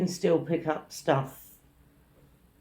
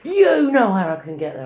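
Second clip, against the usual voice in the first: raised, loud badgering; mimicked yelling; 4 semitones higher, 8 dB louder than usual. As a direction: shout it at someone to demand directions. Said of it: "yelling at me".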